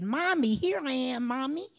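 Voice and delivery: put-on high, loud child's voice calling up to mommy; a small child calling down from the ceiling; little boy calling. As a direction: son calling to his mother from above, high and bright. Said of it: "and he said".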